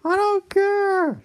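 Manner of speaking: put-on high, slow defiant old man; stubborn cranky dad. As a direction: drag out the refusal, squeaky.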